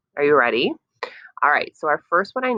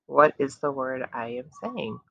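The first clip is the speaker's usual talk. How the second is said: usual speaking voice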